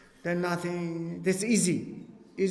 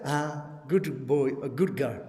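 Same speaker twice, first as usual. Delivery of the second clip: gentle Buddha voice; 5 semitones lower than usual. Retold or imitated. Buddha praises questioning students.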